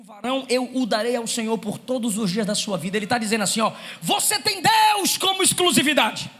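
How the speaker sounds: loud, driving sermon delivery; full-force preaching. Acted. Preacher rousing the congregation, loud and driving. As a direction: preach it hard to the church.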